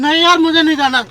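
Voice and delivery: high, loud, panicked refusal; frightened refusal. Real, not acted. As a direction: begs not to be taken away. Trapped passenger refusing loudly. (डरकर ऊँची आवाज़ में इनकार)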